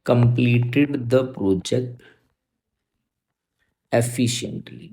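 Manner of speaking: very slow, drawn-out model sentence; teacher dictating slowly; slow dictation. Shown as a demonstration. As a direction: stretch every word. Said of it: "दूसरा sentence क्या होता".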